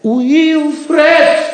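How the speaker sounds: loud, high bellowing call; angry shout. Acted. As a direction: bellow the name across the hall. Седрик зовёт сына. (гневный громкий окрик)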